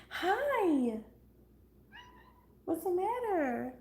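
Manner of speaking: high, slow cooing to a pet. Real, not acted. Baby talk to the cat.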